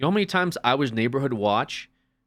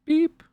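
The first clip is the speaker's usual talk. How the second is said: high-pitched scanner beep; imitated checkout beep; 4 dB louder than usual. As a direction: mimic a store scanner with a short high beep. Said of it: "It's just like, beep".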